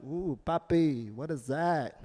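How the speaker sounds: a hint of a coy girlfriend; mock girlfriend. Girlfriend voiced in bedroom joke.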